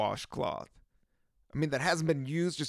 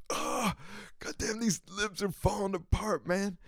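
higher whiny moan; 7 semitones higher than usual. Retold or imitated. Moaning over chapped lips.

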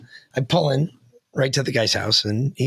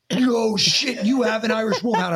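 higher-pitched imitated amazement; amazed; 6 semitones higher than usual. Teller voicing the former sheriff hearing the breed, amazed. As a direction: react with raised, astonished pitch. Retelling the man's amazed reaction.

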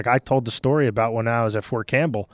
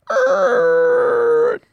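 high screeching tire noise; screeching brakes; 5 dB louder than usual. Retold or imitated. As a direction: imitate a screeching Humvee. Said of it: "I was like".

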